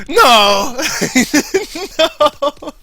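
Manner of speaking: high, loud, hammed-up no; cartoonish mock shout of no; mock Larry no. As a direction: wail no in mock horror. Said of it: "And Larry's just going".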